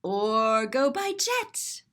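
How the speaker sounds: plain reading voice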